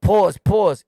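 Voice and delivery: loud imitation of others' catchphrase; mock interjection. Imitating guys who interrupt talk, mock interjection. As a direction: mimic a loud, butting-in interrupter. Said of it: "when you talk".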